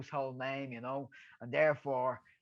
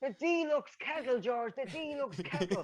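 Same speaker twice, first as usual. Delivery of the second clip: Gibbo insisting; 10 semitones higher than usual. Retold or imitated. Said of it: "He's going".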